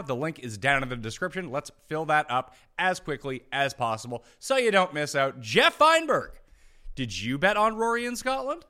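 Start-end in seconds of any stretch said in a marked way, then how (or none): 5.4-6.3 calling out. Acted. Host calls co-host in.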